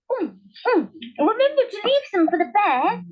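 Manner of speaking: the wife speaks with her mouth full of dal; mouth crammed full. A narrator's reading. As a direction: chew while you talk. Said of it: "speaking with her mouth crammed full".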